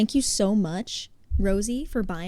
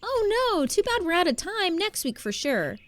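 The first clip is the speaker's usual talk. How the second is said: high fake "oh no", easing down; mock disappointment; 10 semitones higher than usual. Coach fakes regret to the kids, high and sing-song. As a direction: pretend to be sorry, sweetly. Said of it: "And then I go".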